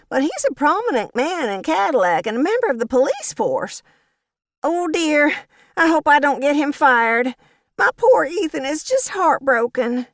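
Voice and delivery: high, sugary fake-sweet old lady; Violet, syrupy gossip. A narrator's reading. Violet spreads gossip to Agnes with high sugary malice. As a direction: drip false sympathy, pitched high.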